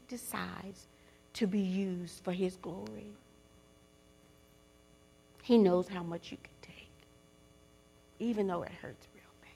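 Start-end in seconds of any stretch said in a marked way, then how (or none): 5.4-9.5 soft, whispered reassurance; tender whisper. Real, not acted. Preacher whispering comfort to the grieving. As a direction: whisper it like comfort to a hurting friend.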